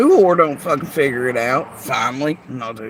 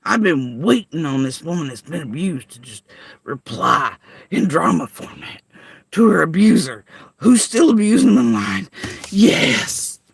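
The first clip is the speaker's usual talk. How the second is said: mock survivor voice; 4 dB louder than usual. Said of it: "Imagine being a woman that's a survivor and being like".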